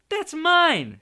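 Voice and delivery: put-on high, loud boy's cry; Harry protesting to his uncle. A narrator's reading. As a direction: claim the letter back at once. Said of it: "said Harry".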